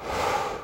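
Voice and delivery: soft, voiceless breath out; deep sigh. Retold or imitated. Golfer calming himself before an eight-foot putt, voiceless sigh. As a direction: let it all out before the putt. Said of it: "and I went".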